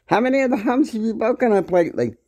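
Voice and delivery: high, fast, sneering accusation; imitated accusing yell. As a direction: throw the accusation in his face. Calling him every name.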